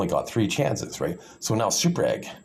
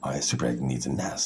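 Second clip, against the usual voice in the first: usual speaking voice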